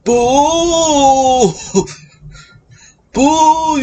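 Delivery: hammy ghost with Yiddish lilt. Retold or imitated.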